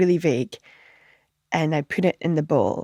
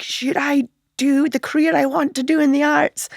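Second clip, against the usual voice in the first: mock inner scream; 7 semitones higher than usual. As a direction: shout the question at him in your head, playfully. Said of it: "screaming in my head".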